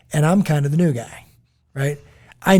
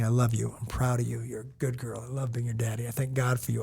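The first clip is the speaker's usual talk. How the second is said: quiet blessing; 3 semitones lower, 9 dB quieter than usual. Retold or imitated. Father's blessing repeated with daughters.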